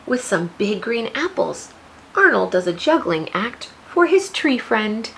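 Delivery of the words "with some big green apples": ordinary talk, nothing marked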